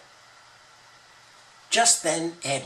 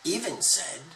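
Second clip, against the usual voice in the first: usual speaking voice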